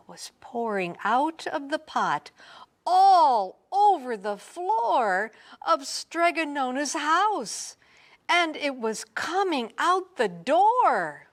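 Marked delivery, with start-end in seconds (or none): 2.8-11.3 excited. A narrator's reading. Pasta pouring everywhere, rising drama.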